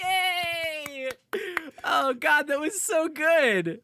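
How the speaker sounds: loud, high delighted cheer; excited cheering. Real, not acted. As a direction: cheer it out, thrilled. Cheering after the ad.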